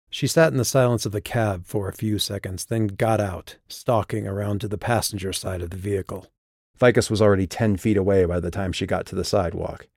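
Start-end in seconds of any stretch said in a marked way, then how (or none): none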